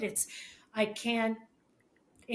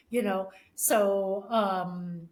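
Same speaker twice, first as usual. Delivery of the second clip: ordinary delivery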